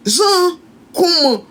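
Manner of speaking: mock-innocent, high-pitched reaction; feigned surprise. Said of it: "elle est comme ça".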